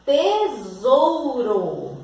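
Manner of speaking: very slow, syllables stretched; drawn-out word. Shown as a demonstration. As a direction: stretch the key word out for pupils. Stretching the key word for pupils. (palavra bem esticada, sílaba por sílaba)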